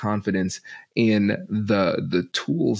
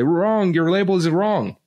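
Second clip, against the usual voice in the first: much higher, emphatic "Wrong"; mock offended, pitched up high; indignant correction; 9 semitones higher, 3 dB louder, slower than usual. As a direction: snap back at a wrong label. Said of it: "They're gonna say".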